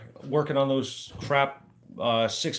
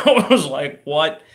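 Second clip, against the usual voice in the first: laughing at step one remove body; laughing disbelief; 3 semitones higher, 8 dB louder, slower than usual. Real, not acted. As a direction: laugh out a stunned what.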